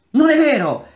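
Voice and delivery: very high, loud shout of denial; shrill desperate cry; anguished denial. A narrator's reading. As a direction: scream it to block out the truth. Atarva denies his true past.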